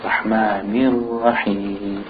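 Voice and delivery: Rahmanir Rahim slow, with a firm i after n; stronger kasra. Shown as a demonstration. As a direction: sound nir clearly in measured Rahmanir Rahim. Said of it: "make the kasra a little bit stronger".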